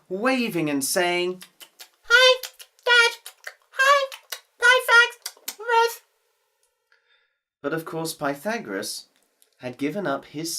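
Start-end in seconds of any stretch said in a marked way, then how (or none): 2.1-6.0 put-on high, loud child voice calling out; high excited girl shouting hello; little girl calling. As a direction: wave and call like a happy girl. Girl waves from donkey.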